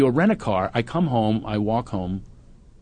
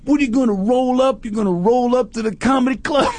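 put-on swagger it like a suburban tough kid; gangster-posing teen; 11 semitones higher than usual. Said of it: "that gangster thing".

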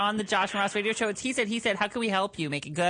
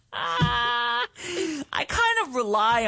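slow, laughing through the words; 14 semitones higher, 4 dB louder, much slower than usual. Real, not acted. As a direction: laugh while pleading. Laughing through radio plea.

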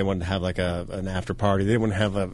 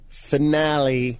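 finale said slow and drawn out; drawn out like it's in quotes; air quotes; 5 semitones higher, much slower than usual. Real, not acted. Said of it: "I'm doing the finger quotes".

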